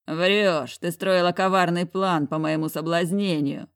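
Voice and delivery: ordinary delivery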